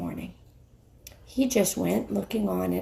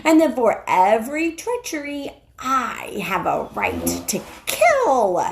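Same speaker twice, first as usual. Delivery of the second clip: growing shriller; 3 semitones higher, 4 dB louder than usual. Acted. The witch proclaiming her right over traitors, shrill and fierce. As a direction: rise in pitch and force line by line. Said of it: "her voice growing suddenly shriller".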